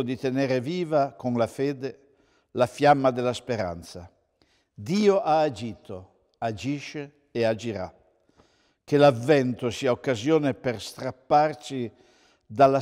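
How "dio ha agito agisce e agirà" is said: ordinary delivery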